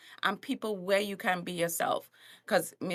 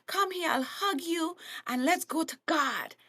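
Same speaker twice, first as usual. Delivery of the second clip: a hint of a gentle preacher, higher; lightly voiced Joel Osteen; 5 semitones higher than usual. Preacher to sinner, lightly voiced gentle hug. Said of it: "Joel Osteen be like".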